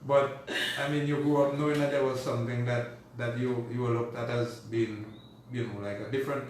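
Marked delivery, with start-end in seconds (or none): 0.0-1.9 loud, high protest through laughter; real laugh in a raised voice; laughing rebuttal. Real, not acted. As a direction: push back good-humouredly while laughing. Laughs back at her teasing challenge.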